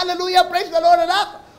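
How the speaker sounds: high, laughing praise; an overjoyed mother laughing her praise to her son; joyful laughing mother. Acted. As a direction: burst out praising through a laugh. Mother leaps with joy.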